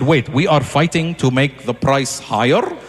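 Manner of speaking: low, slow puzzled elder; confused uncle voice. Retold or imitated. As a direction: sound baffled by the auction. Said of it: "They said".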